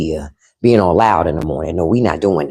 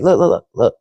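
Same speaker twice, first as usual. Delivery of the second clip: ordinary talk, nothing marked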